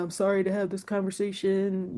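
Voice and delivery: softly imitating a saddened boss; sad, apologetic. Executive editor breaking layoff news, mildly sad. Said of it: "like, so sad".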